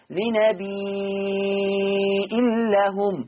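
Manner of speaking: لنبي with the ee sustained very long before the hamza; long steady chanted ee in لنبي, hamza, لهم; six-count madd. Shown as a demonstration. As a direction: hold the last vowel of لنبي fully, then a glottal stop and لهم. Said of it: "ست حركات الإشباع".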